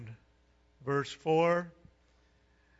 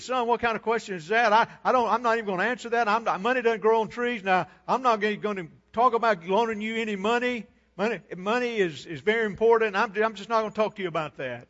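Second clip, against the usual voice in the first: acted exasperation, higher-pitched and pressed; irritated father; 6 semitones higher, 5 dB louder, faster than usual. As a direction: scold your son like a tired, fed-up dad. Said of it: "He was irritated".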